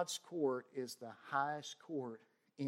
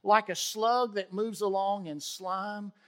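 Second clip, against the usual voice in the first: fervent scripture reading; 5 semitones higher, 5 dB louder, slower than usual. Acted. Continues psalm reading.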